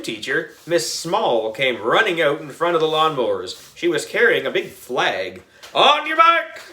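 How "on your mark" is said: high yelled start of the race; yelled start call. A narrator's reading. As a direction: call the start like a gym teacher. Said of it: "she yelled".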